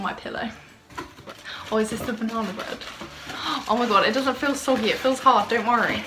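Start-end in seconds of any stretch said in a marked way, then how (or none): 2.8-3.6 quick, soft intake of breath; surprised gasp. Real, not acted. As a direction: gasp with delight at a gift. Finds the banana bread.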